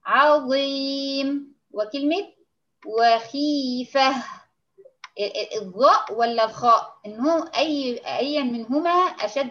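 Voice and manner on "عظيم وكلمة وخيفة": عظيم and وخيفة drawn out slowly, heavy ظ; slow demo words. Shown as a demonstration. Teacher models عظيم and خيفة slowly for students. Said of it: "لو جينا نقول كلمة زي مثلًا كلمة".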